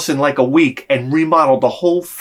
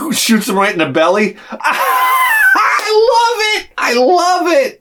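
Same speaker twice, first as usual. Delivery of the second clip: shouting through high laughter; laughing; 14 semitones higher, slower than usual. Real, not acted. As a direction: crack up and yell with joy. Laughing at the scene.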